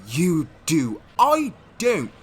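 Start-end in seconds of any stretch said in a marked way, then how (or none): 1.2-2.1 loud, high insistent denial; heated denial. Acted. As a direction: shout it back, insisting. Heated back and forth denial.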